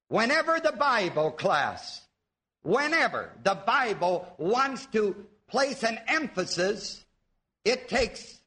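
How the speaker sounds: ordinary talk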